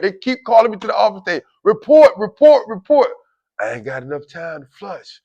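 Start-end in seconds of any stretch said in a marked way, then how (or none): 1.6-3.1 imitating an officer's loud summons; mock summons. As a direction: call it out like a repeated page.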